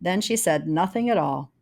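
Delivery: usual speaking voice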